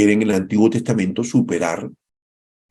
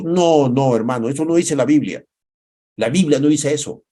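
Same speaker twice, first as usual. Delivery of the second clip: ordinary talk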